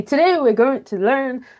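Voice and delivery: a teacher giving the word away too soon; announcing the word. Shown as a demonstration. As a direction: show the wrong way of just telling them the word. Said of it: "Don't say".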